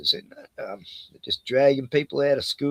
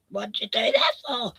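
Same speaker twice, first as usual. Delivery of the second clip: high-pitched imitation of a shocked wife; distressed wife; 9 semitones higher than usual. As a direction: cry out the question as the stunned wife. Retells wife's reaction to husband being shot.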